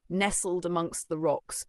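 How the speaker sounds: usual speaking voice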